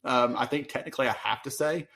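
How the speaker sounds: ordinary delivery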